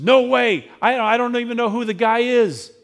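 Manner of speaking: frightened Peter shouting his denial; panicked denial. Acted. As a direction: shove the accusation away in alarm. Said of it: "Peter says".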